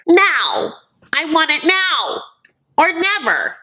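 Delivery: put-on high, whiny demanding child voice; bratty little kid. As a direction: stamp your foot like a spoiled kid who wants it now. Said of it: "He seemed like a little kid".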